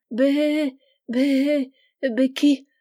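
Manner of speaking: lightly voiced weeping goat bleat; tearful bleating. Widowed goat begs the boy, a hint of tearful bleating. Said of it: "en versant de grosses larmes".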